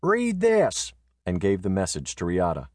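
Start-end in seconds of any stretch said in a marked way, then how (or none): 0.0-0.9 high, curt instruction; curt order. Acted. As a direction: hand it over briskly. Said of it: "Dickerman said".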